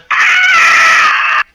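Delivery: long high raptor screech; loud, piercing dinosaur shriek. Shown as a demonstration. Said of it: "That's very, very similar".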